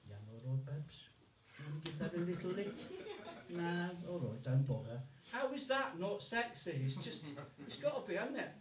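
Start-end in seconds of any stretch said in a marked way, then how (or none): 0.1-2.7 would-be seductive Brummie voice; Brummie accent. Shown as a demonstration. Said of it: "we use the same phrase". 3.5-5.0 resigned Brummie brush-off; deflated Brummie voice; Brummie accent. Shown as a demonstration. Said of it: "if I was a Br".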